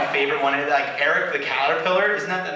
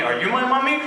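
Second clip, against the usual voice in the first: put-on higher childlike mommy question; lost child asking; 6 semitones higher, faster than usual. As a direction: ask like a lost little kid.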